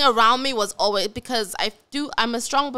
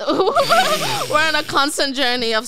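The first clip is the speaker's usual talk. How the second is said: laughing; 6 semitones higher, 4 dB louder than usual. Real, not acted. Laughing at shared joke.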